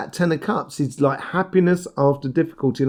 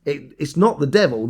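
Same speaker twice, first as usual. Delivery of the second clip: normal talking voice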